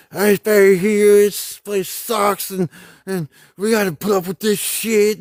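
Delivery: whiny complaining. Retold or imitated. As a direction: mimic a soldier moaning about base life. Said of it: "running their damn mouth".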